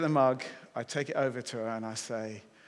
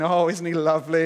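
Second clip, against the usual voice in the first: gushing, louder mock admiration; cooing women; 2 semitones higher, 9 dB louder than usual. Preacher imagines the women in the room reacting, mock cooing. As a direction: imitate an audience cooing approval. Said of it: "all the women in the room now are going".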